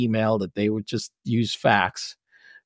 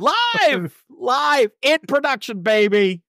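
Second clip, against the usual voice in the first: mock triumphant cheer; 13 semitones higher, much slower than usual. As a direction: cheer it like a rock star. Mocking cheer at editing live.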